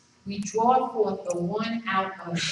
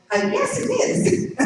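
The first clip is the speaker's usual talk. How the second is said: high, slow, hesitant mock reply; baffled agreement; slower than usual. Speaker answering a man he thinks has lost it, baffled. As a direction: humour someone who may have lost it.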